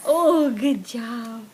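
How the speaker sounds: drawn-out loud praise; slow warm praise. Real, not acted. Praising toddler.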